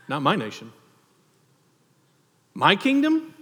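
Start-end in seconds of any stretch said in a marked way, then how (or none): 2.5-3.3 high, rising disbelieving question; mock surprise. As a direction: throw it up as if absurd. Preacher voicing Jesus' rhetorical question.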